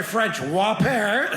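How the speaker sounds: French, Whopper drawn out very slowly; slow mock correction. Comedian correcting himself to the crowd, slow deliberate drawl. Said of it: "Oh, I'm sorry".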